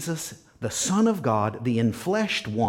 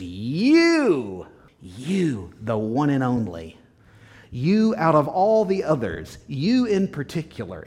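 "You" hit loud and drawn out at the start; emphatic YOU; 3 semitones higher, 4 dB louder than usual. Acted. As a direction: punch every "you" as if singling out one person. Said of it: "Any other emphasis on that word?".

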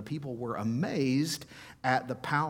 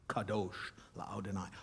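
Kadosh la'Adonai, much softer and lower; soft reverent repeat; 4 semitones lower, 9 dB quieter than usual. Shown as a demonstration. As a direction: hush it like a prayer. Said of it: "holy to God".

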